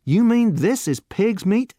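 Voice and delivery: stunned by the word; shocked. A narrator's reading. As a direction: youth shocked by the answer. Shocked youth.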